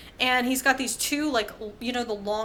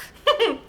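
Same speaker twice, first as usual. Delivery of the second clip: high laugh; 9 semitones higher, 5 dB louder than usual. Real, not acted. Laughs at own slip.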